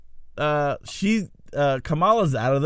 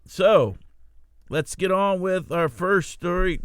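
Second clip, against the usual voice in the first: usual speaking voice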